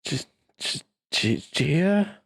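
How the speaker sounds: broken man recognizing his sister; stammering disbelief. Acted. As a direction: struggle to get her name out. Prisoner stammers sister's name.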